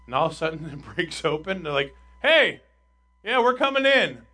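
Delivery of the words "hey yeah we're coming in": high, loud shout down to a crowd; loud, brash announcement of arrival; calling out. Acted. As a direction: holler it as you burst in. Voices men breaking through roof.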